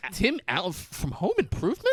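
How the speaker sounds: high-pitched incredulous question; mock starstruck. Man recognizing a TV star, mock starstruck. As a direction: squeak it in hammed-up disbelief.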